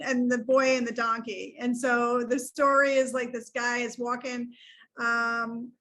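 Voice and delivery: usual speaking voice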